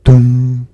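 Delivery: loud, booming "dong" held on one note; clock chime. A narrator's reading. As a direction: imitate a striking clock, ringing out the hum. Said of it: "rang the clock".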